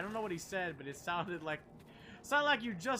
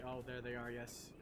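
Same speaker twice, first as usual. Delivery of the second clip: ordinary talk, nothing marked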